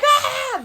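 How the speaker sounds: high, loud imitated yell; belted high "ah" with laughter; kids' chorus yell. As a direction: mimic kids yelling the chorus. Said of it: "And, like, yell it".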